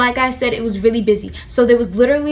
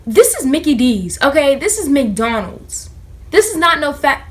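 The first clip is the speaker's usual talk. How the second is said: exasperated rant; 3 semitones higher than usual. Retold or imitated. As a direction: snap it out, fed up with her. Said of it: "I'm like".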